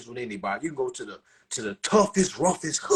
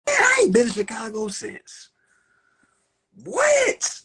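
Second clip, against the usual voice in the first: shouted high and loud, far from his usual voice; emphatic shout; 12 semitones higher, 7 dB louder, slower than usual. Real, not acted. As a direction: belt it out so the back row hears.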